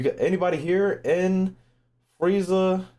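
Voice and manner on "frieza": ordinary talk, nothing marked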